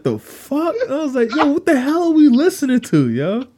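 very high, incredulous outburst; appalled disbelief. Retold or imitated. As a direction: pitch it way up, aghast, as if retelling. Retold appalled reaction to gross story.

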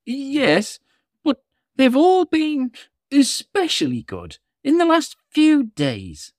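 put-on high little old elf voice; christmas elf. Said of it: "said the elf".

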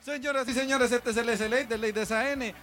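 long stretched show-host welcome; announcer voice. Acted. Host restarts the show intro.

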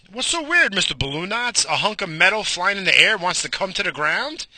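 high, mocking listener voice; mock heckler. Imagined listener mocking him, high and sneering. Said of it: "you make a point too".

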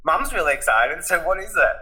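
high-pitched, excited prodding; eager dad. Retold or imitated. Dad phoning his son for the big news, eager. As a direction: play an excited father pressing for news.

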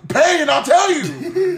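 high, slow retort through amusement; mock exasperated demand. Bus driver to rider asking directions, mock exasperated. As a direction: push it up high and stretch it out.

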